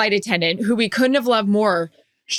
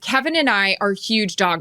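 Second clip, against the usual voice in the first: ordinary delivery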